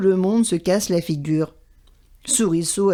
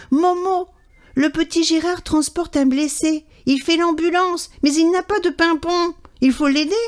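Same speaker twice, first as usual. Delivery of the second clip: higher, lightly voiced urgent bird; urgent magpie; 7 semitones higher than usual. A narrator's reading. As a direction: hurry to raise the alarm. Magpie urgently alerts the crow.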